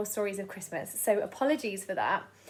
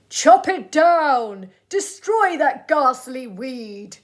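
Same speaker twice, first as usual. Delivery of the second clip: slow, loud villain's bellow; hammed-up tyrant roar; mayor bellowing; 2 semitones higher, 9 dB louder, much slower than usual. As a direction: boom out the order to destroy it. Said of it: "and now he bellowed".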